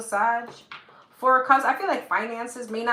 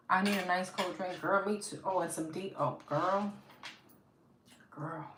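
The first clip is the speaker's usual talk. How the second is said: ordinary talk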